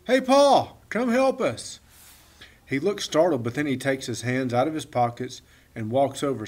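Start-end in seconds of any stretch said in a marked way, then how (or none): 0.0-1.8 high, loud call across the yard; shout. Acted. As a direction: shout to the boy by the wall. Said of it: "I shout".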